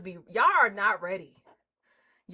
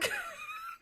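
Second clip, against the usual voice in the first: very high squealing laugh; 9 dB quieter than usual. Real, not acted. Streamer squealing with laughter greeting a friend.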